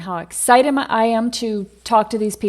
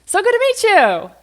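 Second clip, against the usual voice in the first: much higher, louder, beaming hello; excited greeting; 9 semitones higher, 5 dB louder than usual. Shown as a demonstration. Demoing a confident hello on set: excited greeting. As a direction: show them how glad you are to meet them. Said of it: "think about how excited you are".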